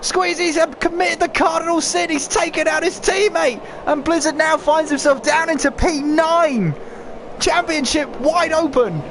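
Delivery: shocked high shouting race call; excited. Real, not acted. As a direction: erupt at the crash in disbelief. Teammates crash, commentator erupts.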